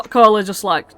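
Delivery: ordinary talk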